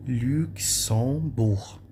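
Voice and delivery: "Luxembourg" drawn out as luk-son-bour; French pronunciation demo. Shown as a demonstration. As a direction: say "Luxembourg" very slowly, G silent. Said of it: "Não pronuncia a letra G".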